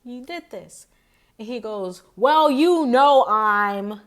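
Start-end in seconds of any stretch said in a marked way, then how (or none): 0.0-0.9 lightly imitated English accent, softer; mock English accent, quieter voice. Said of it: "in her accent, 'cause, you know, she's English". 2.1-4.0 loud, drawn-out mock reply; slow, booming imitation of his comeback; loud acted retort. Acting out his reply to her.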